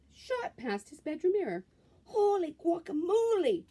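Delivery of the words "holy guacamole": surprised exclamation. A narrator's reading. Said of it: "exclaimed Skippy John Jones".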